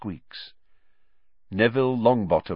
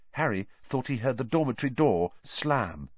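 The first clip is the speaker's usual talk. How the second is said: plain reading voice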